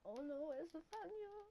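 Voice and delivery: put-on high, slow, fretful girly voice; mimicking worried girls. Girls searching for him, mock high worried girly voice. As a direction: play a panicking girl calling out. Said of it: "they were like".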